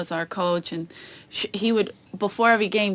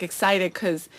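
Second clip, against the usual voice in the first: ordinary talk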